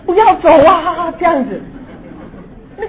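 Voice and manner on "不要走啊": high, slow, loud frightened plea from the toilet; little sibling begging someone to stay, near tears; scared calling out. Retold or imitated. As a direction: call out with a crying child's fear. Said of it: "还要叫出来".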